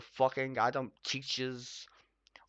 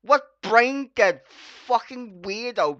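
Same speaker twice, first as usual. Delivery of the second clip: furious shout; 6 semitones higher, 10 dB louder than usual. Real, not acted. Ranting vlogger yelling at the TV, furious shout. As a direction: spit out each word in fury.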